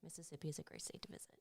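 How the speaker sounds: fast whispered aside; whispering. Real, not acted. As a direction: slip in a quick whispered plug. Whispered joke plug.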